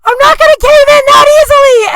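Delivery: high, loud screamed defiance; screaming. Retold or imitated. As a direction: scream it into the intercom. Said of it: "screamed in and was like".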